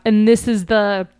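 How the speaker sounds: ordinary talk, nothing marked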